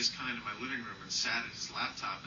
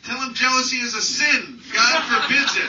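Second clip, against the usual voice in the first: loud, high preachy call, breaking into a laugh; mother yelling; 12 semitones higher, 14 dB louder than usual. Retold or imitated. Mother shouting advice about sin toward the phone. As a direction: holler a scolding from across the house. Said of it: "my mother yelled from the background".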